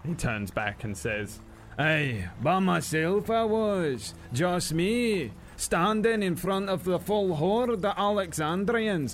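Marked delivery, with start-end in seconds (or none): 1.8-9.1 lightly voiced dwarf, Scots lilt, bragging; boastful dwarf. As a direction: puff up the tale like an old soldier. Dwarf retells his last stand.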